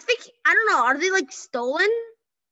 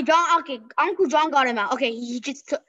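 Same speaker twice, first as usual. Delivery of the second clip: normal talking voice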